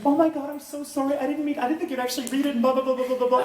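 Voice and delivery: high, rushed, apologetic fangirl; breathless high-pitched gush; gushing teen fan. Retold or imitated. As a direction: imitate a flustered teenage fan. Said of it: "she's like".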